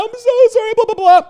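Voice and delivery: high, loud whiny mock sobbing apology; mock crying girlfriend. Mocking his crying ex-girlfriend's apology, high and whiny. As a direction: mimic her tearful sorry mockingly. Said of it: "She's crying".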